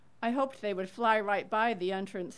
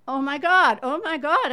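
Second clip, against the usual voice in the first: higher, much louder shocked cry; retold scream; 7 semitones higher, 12 dB louder than usual. Neighbor hearing whose mother died, loud shocked scream. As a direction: shout oh my God in shock. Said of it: "I screamed".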